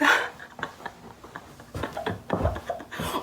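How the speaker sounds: re-enacted stoned laughing fit; long breathy acted-out laugh; high giggling fit. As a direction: laugh helplessly, mostly breath. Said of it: "I'm just laughing 'cause everything is funny".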